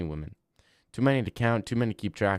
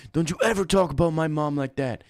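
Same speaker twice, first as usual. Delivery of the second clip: higher, louder stern warning; mock angry warning; 6 semitones higher, 3 dB louder than usual. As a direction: scold the chatter for insulting mom. Repeated warning.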